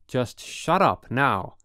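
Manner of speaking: loud, slow, threatening warning; angry warning. A narrator's reading. Roommate warning Holden under him, slow loud threat. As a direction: spell out each word as a threat. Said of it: "he said with his big, stupid red face".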